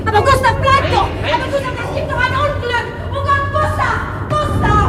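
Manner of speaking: high, loud, frantic cries; wife crying after her husband in the raid, panicked; panicked pleading. Acted. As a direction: beg him not to go, terrified.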